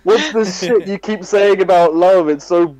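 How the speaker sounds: loud, high, fed-up complaint; exasperated yell. Retold or imitated. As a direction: whine at him that it's boring.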